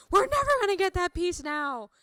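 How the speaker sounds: frustrated meltdown while pounding the desk; upset outburst. Retold or imitated. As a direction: vent like you just lost everything.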